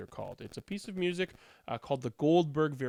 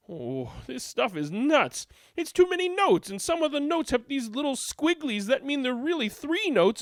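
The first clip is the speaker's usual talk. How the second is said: overwhelmed Goldberg; 8 semitones higher than usual. A narrator's reading.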